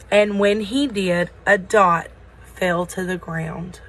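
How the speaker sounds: usual speaking voice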